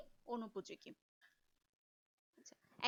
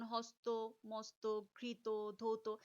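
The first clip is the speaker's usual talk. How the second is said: slow, weighty word list, slightly lowered; grave, solemn; 3 semitones lower, much slower than usual. Shown as a demonstration. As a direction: give each word gravity, one by one. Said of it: "এই যে আমি মাত্রই যে শব্দগুলো বললাম". (গুরুগম্ভীর উচ্চারণ)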